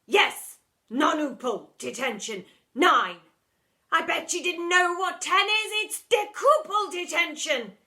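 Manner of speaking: high, loud, frantic shouting; escalating rant. Acted. Deputy head still ranting.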